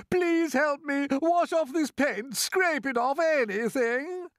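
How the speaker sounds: high, loud, desperate plea; bawling. A narrator's reading. Centipede begs James to remove the paint, bawling. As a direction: bawl for help, dragging out the last word. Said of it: "bawled the centipede".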